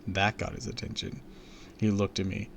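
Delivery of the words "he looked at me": plain reading voice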